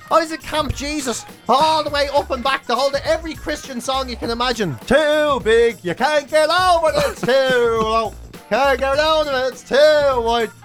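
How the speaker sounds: normal talking voice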